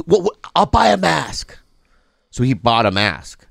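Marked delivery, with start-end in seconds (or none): 0.0-1.6 irritated customer. Retold or imitated. Customer told to buy a mask, mock irritated. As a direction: imitate an indignant man giving in. Angry customer gives in, acted.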